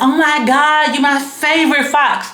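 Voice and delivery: high, breathless gushing praise; squealing flamboyant fan; flamboyant gushing friend. Retold or imitated. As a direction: gush like an excited flamboyant friend.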